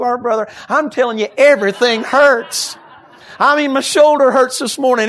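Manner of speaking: loud fervent preacher, pushing hard; preaching at full force. Acted.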